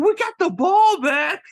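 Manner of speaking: put-on very high, loud boyish protest; squeaky kid voice. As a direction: pipe up like a kid defending himself. Kid yelling defensively.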